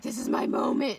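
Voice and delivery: re-enacted eager shout; excited shout. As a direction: announce it like a thrilled fan. Retold excited realisation at concert.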